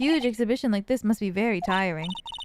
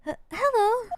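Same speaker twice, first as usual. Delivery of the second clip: timid gasp; 13 semitones higher than usual. Acted. Shy girl greets stranger.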